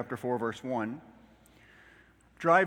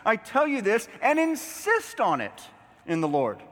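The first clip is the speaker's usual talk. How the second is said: higher, insistent proclamation; emphatic scripture reading; 8 semitones higher, 5 dB louder than usual. Acted. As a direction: insist on it from the pulpit. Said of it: "don't miss that this is emphatic".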